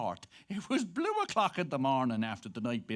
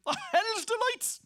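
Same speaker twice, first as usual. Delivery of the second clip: gleeful exclamation; 6 dB louder, slower than usual. Acted. Gleeful exclamation in bawdy tale.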